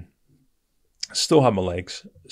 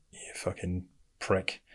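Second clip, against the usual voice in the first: harsh whispered curse at himself; angry whisper; 9 dB quieter than usual. Retold or imitated. Injured soldier swearing at himself in a whisper. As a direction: spit it out under your breath.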